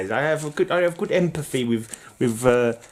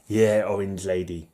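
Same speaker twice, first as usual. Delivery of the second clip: normal talking voice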